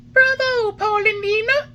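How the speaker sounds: very high, loud tiny cry; a hidden tiny voice teasing Geppetto. Acted. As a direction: squeal it out from nowhere. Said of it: "cried the same tiny voice".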